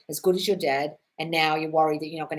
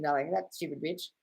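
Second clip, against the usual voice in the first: ordinary talk, nothing marked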